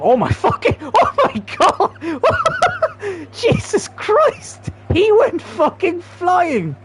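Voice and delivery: high-pitched, hysterical laughing outburst; helpless laughing. Real, not acted. Gamer watching a player go flying, helpless laughing. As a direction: squeal it slowly through real laughter. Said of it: "from laughing at that".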